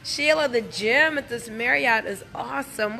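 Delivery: ordinary delivery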